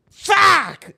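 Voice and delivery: loud, high yelled expletive; explosive one-word outburst; sudden shout. Real, not acted. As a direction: blurt it out high and sharp. Blurted reaction to viewer's comment.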